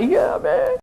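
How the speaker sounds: high-pitched imitation of kids agreeing; mock-enthusiastic kid reply; keen kids. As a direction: chime in like an eager pupil, drawn out. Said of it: "the kids will say".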